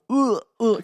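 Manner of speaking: loud, high disgusted ugh; mock gagging. Son gags at the cat's smell, loud. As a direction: gag at the smell.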